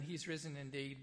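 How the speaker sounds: ordinary talk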